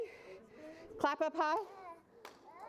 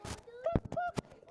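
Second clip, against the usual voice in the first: high-pitched hen clucks; chicken cluck. A narrator's reading. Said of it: "he often liked to cluck".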